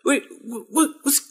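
high, stuttering shock at a sudden healing; stunned disbelief. Retold or imitated. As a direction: imitate a man who can't believe his eyes. Healed man's disbelief retold.

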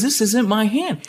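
drawn out like he's staring at his own hand; slow, high, amazed imitation of the healed man; awed disbelief. Healed man's disbelief retold.

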